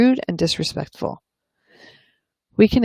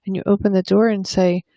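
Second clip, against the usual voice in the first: usual speaking voice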